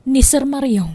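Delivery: ordinary delivery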